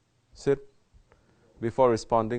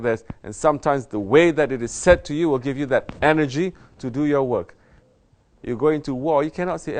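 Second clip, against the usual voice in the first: higher, louder sermon delivery; forceful preaching; 4 semitones higher, 6 dB louder than usual. Acted. Sheikh rebuking murids about training, forceful preaching. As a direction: drive the correction home with force.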